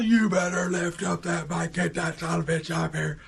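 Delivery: high-pitched mock angry holler; retold dad's furious bellow; imitating yelling dad. Dad yelling at struggling kid, mock angry holler. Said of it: "he starts yelling at me".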